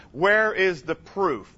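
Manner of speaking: where is the proof drawn out slowly; slow emphatic question. Shown as a demonstration. Preacher challenging the crowd, slow and deliberate. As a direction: lean on each word of Where is the proof. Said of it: "That's the question".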